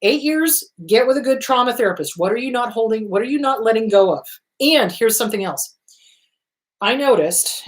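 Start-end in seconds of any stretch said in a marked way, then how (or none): none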